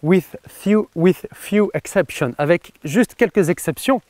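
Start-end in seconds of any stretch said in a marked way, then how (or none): 0.0-2.3 playful English accent on the quote; mock English accent. Shown as a demonstration. Coach quoting Magic Johnson to viewers, in a mock English accent. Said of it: "je vais le dire avec mon super accent anglais".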